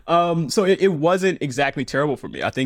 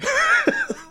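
airy high-pitched laugh; giggling burst. Real, not acted. Laughs at nightmare story.

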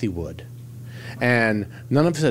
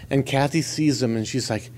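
usual speaking voice

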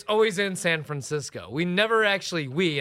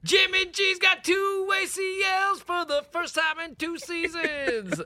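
much higher, drawn-out mock stat; over-dramatize the stat; dramatic reality show hype; 11 semitones higher, slower than usual.

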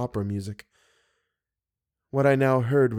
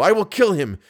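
angry vow; 6 semitones higher, 4 dB louder than usual. A narrator's reading. Raoul vows revenge, enraged.

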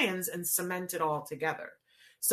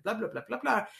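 mock foreign gibberish; 3 semitones higher than usual. Shown as a demonstration. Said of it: "if I was speaking Russian to you".

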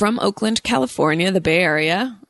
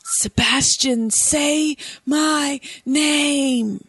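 breathy whisper; 5 semitones higher, much slower than usual. Pretend. Sultry Never Ending Story call.

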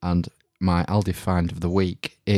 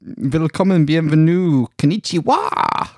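showy host greeting; 9 semitones higher, 5 dB louder, slower than usual. Pretend. Mock cabaret greeting.

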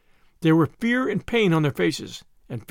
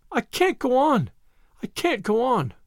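high, lightly acted wail; a little girl's high, despairing cry, understated; weeping; 6 semitones higher than usual. A narrator's reading. Exhausted little girl alone by the hedge, mildly weeping.

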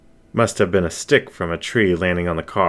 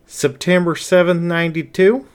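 puzzled question; 10 semitones higher than usual. Acted. The wolf blinked.

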